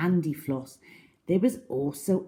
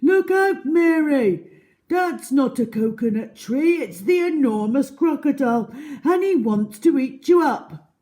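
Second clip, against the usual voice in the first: high, loud frantic warning; urgent shout; 7 semitones higher, 6 dB louder than usual. A narrator's reading. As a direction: bellow it to save her. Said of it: "shouted Humpy Rumpy".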